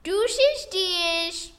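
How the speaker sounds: put-on old grandma sign-off, high and bright; sweet old lady. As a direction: wave goodbye like a sweet granny. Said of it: "we're gonna say Grammy sign-off".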